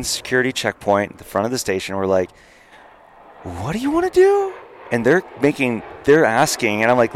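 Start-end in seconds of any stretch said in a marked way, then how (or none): 3.4-4.5 squeaky high mock cop question; high-pitched imitation of a confused officer. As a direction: play the checkpoint cops as a high cartoon voice.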